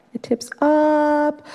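high, drawn-out "up"; rising suspense. Retold or imitated. Storyteller to audience as the raft keeps tipping, rising suspense. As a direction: stretch UP high like a raft lifting.

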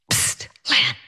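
whispered hiss of a name; stage whisper. Retold or imitated. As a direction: whisper to get her attention unseen. Husband hissing through curtain.

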